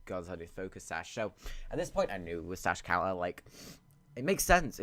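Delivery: ordinary talk, nothing marked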